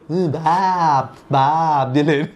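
mock-weary Frenchman drawing out the name; eye-rolling exasperation. Kevin Kline looking out the window, exasperated mimicry. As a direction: imitate a put-out mimicry, tailing into laughter. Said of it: "like, rolls his eyes".

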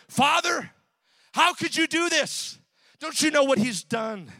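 high, loud, outraged protest; indignant older son. Acted. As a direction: hurl the accusation at the father. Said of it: "what the older son said".

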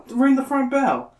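high, slow pleading; begging. Acted. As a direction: beg in a high voice. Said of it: "Gabe begs".

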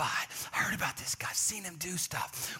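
awed whisper from the crowd; hushed gossip. Acted. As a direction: lean in and whisper the rumor. Crowd members whispering about rabbi.

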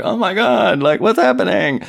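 high, loud hammed-up alarm; mock panic. Retold Berger panicking at being shipped out.

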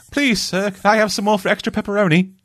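high, pleading little-orphan voice; begging orphan. Pretend. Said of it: "It's like".

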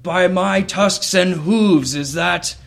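big minotaur voice, loud, high and slow; Gary. Acted. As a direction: hail an old friend in booming, drawn-out disbelief. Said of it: "Gary the Minotaur".

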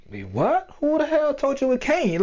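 high-pitched indignant challenge; defiant disbelief. Acted. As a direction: throw it back at the naysayer, voice shooting up. Acting out defiant reply to naysayers.

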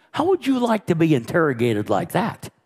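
normal talking voice

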